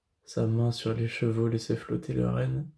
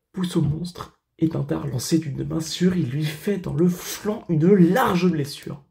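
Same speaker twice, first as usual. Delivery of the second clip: montée héroïque; 4 semitones higher, 5 dB louder than usual. Acted. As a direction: drive the attack higher and louder to the final blow. Heroic attack reaches its climax. (récit épique qui monte, aigu puis fort et étiré)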